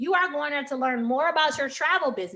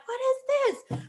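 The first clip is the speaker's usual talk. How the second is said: high-pitched amazement, much higher; amazed; 13 semitones higher, slower than usual. Retold or imitated. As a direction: gasp in disbelief. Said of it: "I was like".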